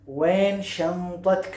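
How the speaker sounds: वैन शंतक stretched very slowly for learners; teacher's slow-motion वैन शंतक; slow model phrase. Shown as a demonstration. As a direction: draw out each syllable of वैन शंतक.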